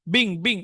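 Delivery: "being" said as one short syllable, like "bing"; pronunciation demo. Shown as a demonstration. Teacher showing students how "being" sounds. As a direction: model "being" for the class as a single quick "bing". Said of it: "উচ্চারণটা হবে being".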